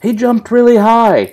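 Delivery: father voicing his toddler's complaint; mock toddler voice. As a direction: imitate a startled little kid. Quoting the scared toddler.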